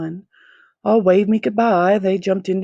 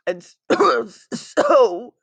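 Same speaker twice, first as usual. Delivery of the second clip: a hint of the Lorax, stumbling over a cough; start, cough, start again; 6 semitones higher, 4 dB louder, much slower than usual. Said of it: "said the Lorax".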